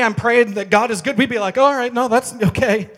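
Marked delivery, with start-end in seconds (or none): 1.6-2.9 higher, louder mock backing away; hammed-up nervous no; mock alarm.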